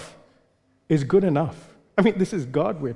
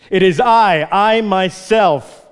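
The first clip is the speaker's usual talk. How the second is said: louder and higher, "I myself" drawn out slow; emphatic; 4 semitones higher, 5 dB louder, slower than usual. Acted. Preacher insisting God alone makes the covenant, slow and emphatic. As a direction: plant each word firmly. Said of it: "preacher stresses God's own doing".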